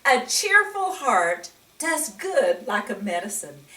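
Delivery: ordinary delivery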